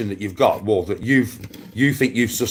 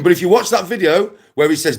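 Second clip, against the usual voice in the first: usual speaking voice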